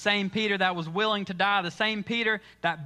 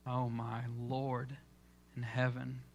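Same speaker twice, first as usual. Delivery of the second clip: hushed awe; 7 semitones lower, 9 dB quieter than usual. Real, not acted. Preacher overwhelmed by the scene.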